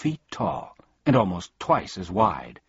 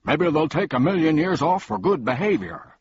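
calling out; 9 semitones higher, 5 dB louder than usual. A narrator's reading. Humbug calls after.

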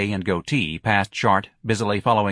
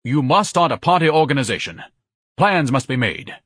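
higher, emphatic declaration; a pompous organizer laying down orders; lightly voiced pompous Ignatius; 6 semitones higher, 5 dB louder than usual.

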